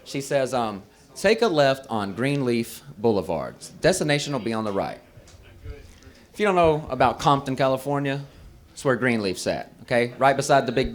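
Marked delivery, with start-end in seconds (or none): 1.1-5.0 low, soft navigation-assistant voice; Siri. Retold or imitated. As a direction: give directions like a phone assistant, low and quiet. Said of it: "I'm listening to Siri tell me".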